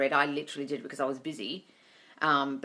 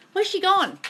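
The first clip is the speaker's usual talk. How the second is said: high, loud call across the room; calling for the dog; 13 semitones higher, 8 dB louder than usual. Real, not acted.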